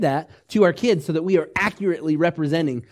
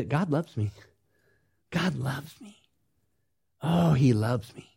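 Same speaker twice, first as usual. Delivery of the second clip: much softer, hushed realisation; quiet inner realisation; 3 semitones lower, 5 dB quieter than usual. Retold or imitated. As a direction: let it sink in, almost to yourself.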